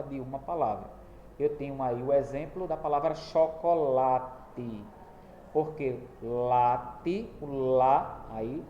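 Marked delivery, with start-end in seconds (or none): 3.1-4.8 chocolate broken into syllables, with a long "la"; drawn-out stressed syllable. Shown as a demonstration. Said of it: "é a sílaba que é pronunciada mais demorada". 6.2-8.2 "late" very slowly, with "la" held long; slow syllable demo of "late", lingering on "lá"; drawn-out stressed syllable. Shown as a demonstration. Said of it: "é a sílaba que é pronunciada mais demorada".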